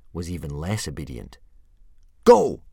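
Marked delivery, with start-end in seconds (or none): 2.2-2.6 a full-throated yell, much higher and louder. Acted. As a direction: bellow the command at the dragon. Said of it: "yelled Hiccup".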